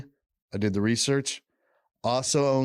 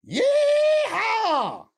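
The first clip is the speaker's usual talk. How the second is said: a full, very high shouted cowboy yeehaw; 4 dB louder than usual. Acted. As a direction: whoop it out like a rodeo cowboy, far higher. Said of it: "That was such a good yeehaw".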